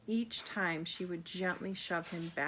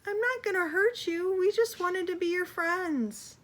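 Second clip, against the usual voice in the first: desperate, much higher and louder plea; pleading Creole; 11 semitones higher, 7 dB louder than usual. Acted. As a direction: beg them to stay and be friends. Creole pleads with fleeing animals.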